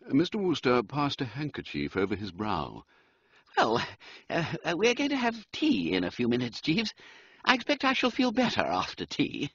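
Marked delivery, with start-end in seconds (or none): 3.6-9.5 high, hopeful toff; a worn-out Bertie clinging to tea; weary upper-class young man; wilting gentleman. Acted. Wooster wilting, hoping for tea.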